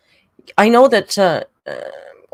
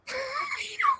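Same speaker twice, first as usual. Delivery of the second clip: high laugh; 10 dB quieter than usual. Real, not acted. Burst of laughter.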